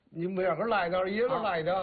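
quick Tangshan dialect imitation; a hint of Hebei Tangshan speech; Tangshan accent. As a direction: lightly do a Tangshan local. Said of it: "唐山的".

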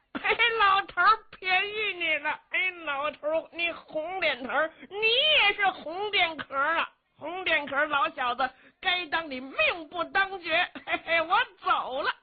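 put-on high, childlike taunting of the old man; big simple lad's voice. A narrator's reading. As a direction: play the dim-witted strongman, gleeful and high. Simple-minded Luo Shixin taunting.

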